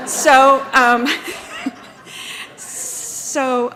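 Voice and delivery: loud burst of real laughter; laughing. Real, not acted. Speaker announces cake to the room, laughing loudly. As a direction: laugh it out over the cake news.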